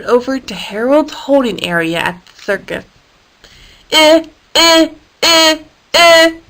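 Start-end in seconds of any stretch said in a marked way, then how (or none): 3.9-6.4 high, loud beeping eh eh; mock beeps at the hippo. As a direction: beep like an alarm. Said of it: "Isaac beeped at him".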